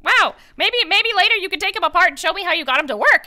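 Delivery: high, loud and fast tiny squeaky creature; excited kobold. Acted. Kobold amazed the robot talks, high, fast and squeaky. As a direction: gush like an excited little kobold.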